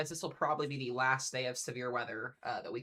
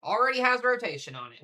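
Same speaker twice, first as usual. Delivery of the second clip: sudden loud, higher burst; excited; 10 semitones higher, 12 dB louder than usual. Real, not acted. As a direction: blurt it out, thrilled. Spots rotation on the storm.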